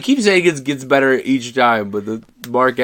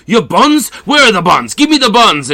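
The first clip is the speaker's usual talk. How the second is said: much higher, louder, cross demand; cross shout; 12 semitones higher, 7 dB louder than usual. Retold or imitated. Glutton yelling at his chauffeur, hammed-up cross shout. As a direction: bellow for the missing buns, hammed up. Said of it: "gets very cross with him and says".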